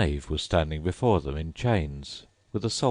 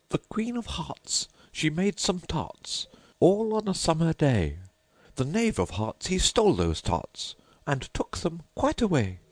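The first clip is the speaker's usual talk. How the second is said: rabbit herald reading; 10 semitones higher than usual. Acted. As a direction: recite it as a pompous little herald.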